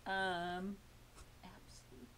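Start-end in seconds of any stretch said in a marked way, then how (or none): 1.4-2.1 whisper. Real, not acted. Aside while unboxing.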